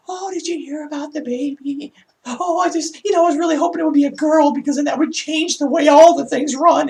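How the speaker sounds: high, breathless English accent imitating a gossiping coworker; mock English gossip. Vlogger imitating coworkers gossiping about the baby, in a mock English accent. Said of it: "I can't do my English accent today".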